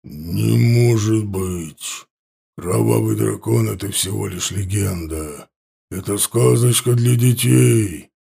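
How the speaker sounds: frown and mutter under your breath; muttering chief. A narrator's reading. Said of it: "нахмурился и пробубнил". (вождь хмуро бубнит)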